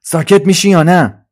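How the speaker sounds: loud, high irritated snap; angry. Acted. Suzi turns on noisy Trolley, angry snap. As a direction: snap it loudly to silence them. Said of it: "با عصبانیت برگشت و گفت".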